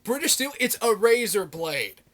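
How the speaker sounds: can't believe they picked that album; outraged disbelief. Retold or imitated. Fan reacting to a metal show's album pick, loudly incredulous. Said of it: "I'm like".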